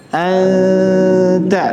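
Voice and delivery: Anta with the n half hidden as a nasal hum; ikhfa, hidden nun. Shown as a demonstration. Teacher showing students ikhfa, Anta with a nasal hum for the nun. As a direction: let the n of Anta fade into a nasal hum before the t. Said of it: "Coba sekarang baca dengan ifa".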